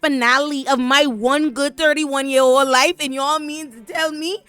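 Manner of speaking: high, loud exasperated rant; mad rant. Real, not acted. Ranting to viewers about the finale, loud and exasperated. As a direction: vent the outrage at full tilt. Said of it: "But I'm mad".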